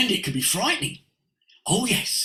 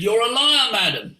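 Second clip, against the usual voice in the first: retold accusation; 5 semitones higher, 6 dB louder than usual. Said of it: "I said".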